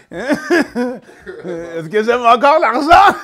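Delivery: high, loud, drawn-out question through laughter; laughing shout. Real, not acted. Comedian asking if he still loves money, shouting with laughter. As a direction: crow it out while cracking up.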